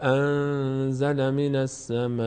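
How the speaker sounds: slow, measured tajweed chanting; unhurried, sustained recitation; Quran recitation. Shown as a demonstration. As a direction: hold the long vowels and nasals on an even note. Teacher recites verse.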